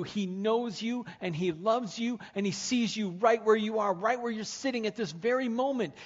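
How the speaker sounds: fervent preacher pleading with the hurting listener; full-force preaching. Real, not acted. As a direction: preach it like the room depends on it.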